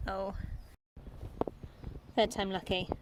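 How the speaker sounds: usual speaking voice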